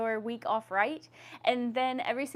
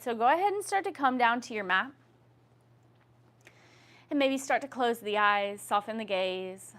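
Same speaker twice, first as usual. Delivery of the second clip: yoga instruction. Acted. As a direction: lead the class gently through breath. Leads a guided yoga breathing practice.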